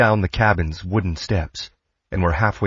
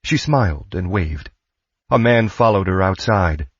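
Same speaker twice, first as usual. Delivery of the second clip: usual speaking voice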